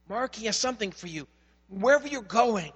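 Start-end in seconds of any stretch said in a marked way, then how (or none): none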